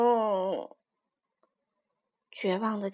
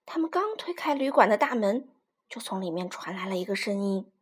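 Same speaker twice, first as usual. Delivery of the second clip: plain reading voice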